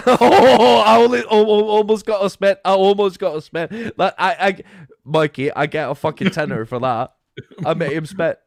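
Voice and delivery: loud, high, laughing triumph; gleeful yelling victory; triumphant shout. Real, not acted. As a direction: crow over making him spit.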